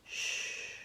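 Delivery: soft unvoiced hush; whispered shh. The mouse hushing everyone, whispered. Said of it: "the mouse says".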